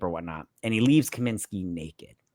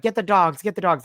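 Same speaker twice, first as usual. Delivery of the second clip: higher, louder repeated call; mock urgent order; 7 semitones higher, 4 dB louder than usual. Officers calling for the dogs, mock urgent order. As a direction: imitate cops calling out in alarm.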